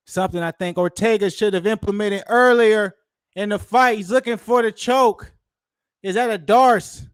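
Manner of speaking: calling the grappling like a hyped announcer; hyped-up commentary. Real, not acted. Fan calling the fight live to viewers, hyped and high. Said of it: "I don't know why I'm talking like that".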